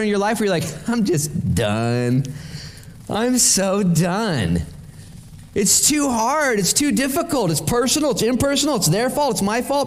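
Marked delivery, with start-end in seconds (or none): 0.9-4.6 slow, drawn-out exasperation; fed-up quitting. Acted. Worn-down believer giving up like Peter, slow and fed up. Acting out wanting to quit. 5.5-9.9 high-pitched litany of excuses; exasperated complaining. Acted. Believer listing reasons to quit like Peter, exasperated and high.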